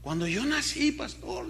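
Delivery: put-on high, softening voice of a tearful girl; imitated crying. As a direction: imitate her choking up on "pastor". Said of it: "y la muchacha lloraba". (imitando un llanto con voz aguda)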